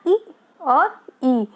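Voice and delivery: "ई और ई" drawn out slowly, first long then short. Shown as a demonstration. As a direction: stretch the two vowels so the difference in length is heard. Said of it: "तो इसमें changes आ रहा ना". (ई और ई धीरे-धीरे, लंबा और छोटा)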